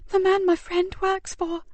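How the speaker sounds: put-on slow, high, hushed boy's voice; a scared boy barely getting it out; frightened boy. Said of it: "he whispered".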